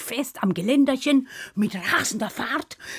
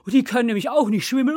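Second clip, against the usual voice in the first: usual speaking voice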